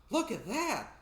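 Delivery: high, loud amazed cry; gasping excitement. Acted. Thomas amazed at the shark, excited gasp. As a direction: gasp it out in wonder. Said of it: "Thomas gasped".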